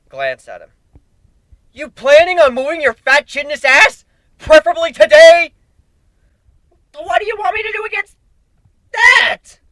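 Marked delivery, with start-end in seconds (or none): 1.7-5.5 much higher, much louder voiced partner barking; impatient snap. Hero yelling at the changeling to move, an angry high bark. 6.9-9.6 much higher, louder squeaky changeling voice; panicked protest. Acted. Changeling protesting against the huge foe, a high panicked squeak.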